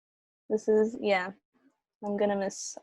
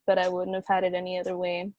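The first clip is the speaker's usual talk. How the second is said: ordinary delivery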